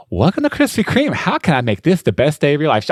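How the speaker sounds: high, bubbly customer-service sing-song; super chipper drive-thru lady. Retold or imitated. Imitating the drive-thru lady's chipper greeting at the intercom. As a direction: beam like a theme-park greeter. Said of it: "super chipper, super happy".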